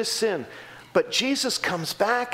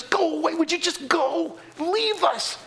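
much higher, pushy plea to leave; townspeople telling Jesus off; 9 semitones higher than usual. Retold or imitated. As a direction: voice the crowd driving Jesus out. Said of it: "men come up and say to Jesus".

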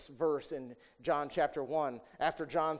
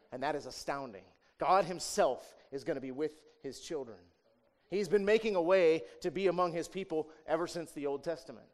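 ordinary talk, nothing marked

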